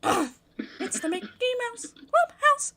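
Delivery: very high, slow squeaky cartoon mouse; Mickey Mouse voice. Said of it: "give Monica Mickey's voice".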